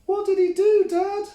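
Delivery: put-on very high, loud child voice; excited kid goat. A narrator's reading. Billy the Kid begs dad, high and loud. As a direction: squeal it out eagerly. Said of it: "asked Billy the Kid".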